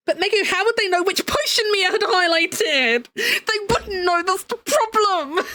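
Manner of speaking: mock-whiny protest; mock objecting viewer. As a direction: parody a fretting viewer, slowing down at the end.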